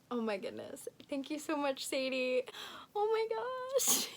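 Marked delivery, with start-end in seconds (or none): 2.9-4.1 high, drawn-out squeal; slow, high-pitched gushing; overwhelmed squeal. Real, not acted. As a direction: stretch it out high with delight. Overwhelmed by the gift.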